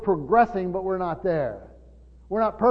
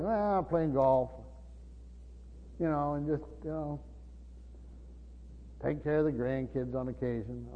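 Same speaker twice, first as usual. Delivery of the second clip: mildly listless imitation, trailing softer and lower; idle retiree; 4 semitones lower, 12 dB quieter than usual. Imitating retired friends telling him what they do, mildly listless. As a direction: answer lazily, with long pauses, fading away.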